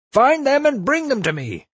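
furious, very high-pitched shouted order; enraged bellowing. A narrator's reading. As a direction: roar the command across a distance. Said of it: "he bellowed".

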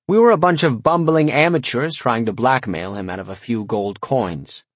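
plain reading voice

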